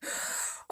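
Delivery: a sudden breathless gasp of horror; sharp gasp. Acted. Mother realising her daughter became the plant, gasp. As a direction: gasp as it dawns on you.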